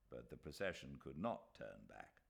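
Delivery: plain reading voice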